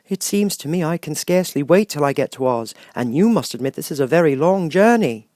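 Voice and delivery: lightly voiced sad tin man; Tin Woodman voice. A narrator's reading.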